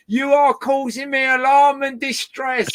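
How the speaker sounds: loud, high announcement through a megaphone. Retold or imitated. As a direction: blare it out like a megaphone warning. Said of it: "I got my megaphone off".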